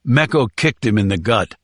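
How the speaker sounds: ordinary delivery, nothing marked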